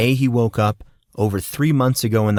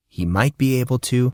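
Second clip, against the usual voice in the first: usual speaking voice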